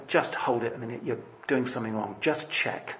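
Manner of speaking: a hushed nudge to stop and check; the Lord quietly checking you; gentle warning. Pretend. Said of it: "it's as if the Lord's saying".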